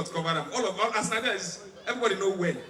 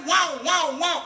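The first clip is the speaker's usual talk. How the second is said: raised, swooping wow-wow; imitated ambulance siren; 6 semitones higher, 5 dB louder, slower than usual. Comedian mimics the siren he heard in traffic, swooping wail. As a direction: wail it louder and higher, like a siren. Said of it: "As I hear".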